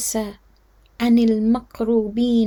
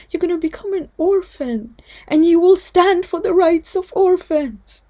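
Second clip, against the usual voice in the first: mournful father; 6 semitones higher, 7 dB louder than usual. Acted. Imam answers his daughter.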